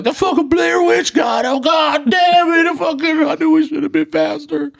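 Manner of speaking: very high, wailing panic; hammed-up tearful shout, dragged out; mock crying. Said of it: "I'm sitting there crying".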